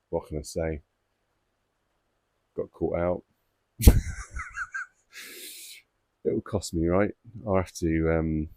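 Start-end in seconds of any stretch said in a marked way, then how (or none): 3.8-4.8 a sudden squeal of a laugh, much higher; high squeaky laugh. Real, not acted. Koi keeper laughing at being caught out by his wife, high squeaky laugh. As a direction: burst into a squeaky giggle.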